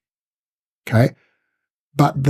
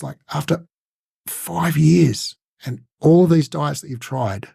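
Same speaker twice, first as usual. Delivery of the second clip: curious tonality; 2 semitones higher than usual. Shown as a demonstration. Coach asking a client why now, curious. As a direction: wonder aloud, genuinely puzzled. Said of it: "So it's a curious tonality".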